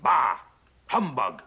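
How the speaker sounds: storyteller giving a grumpy yell; Scrooge's yell. A narrator's reading. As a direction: read aloud as a loud, curt exclamation. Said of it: "Scrooge would yell".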